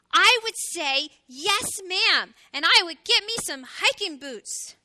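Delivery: loud, high, emphatic promise; eager little hedgehog. A narrator's reading. Little hedgehog promising to climb a mountain, eager. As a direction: sing out the answer with gusto.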